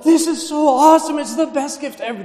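put-on high, loud, gushing kid voice; excited child. Nephew opening his first Christmas present, high and gushing. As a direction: squeal it like a six-year-old tearing into gifts. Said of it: "shaking with excitement".

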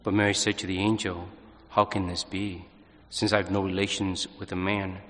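measured, solemn scripture reading; gospel reading. Acted. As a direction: proclaim the Gospel calmly and steadily. Priest proclaims the Gospel reading.

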